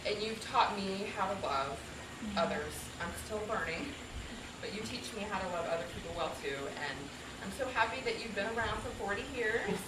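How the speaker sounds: soft, sincere words to a husband; real heartfelt affection, hushed; tender tribute. Real, not acted. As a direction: speak quietly and lovingly to your spouse.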